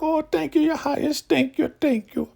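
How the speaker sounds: extremely high, squeaky mock thanks; grovelling gratitude. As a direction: imitate a fawning underling gushing in falsetto. Acted grovelling thanks to the ruler.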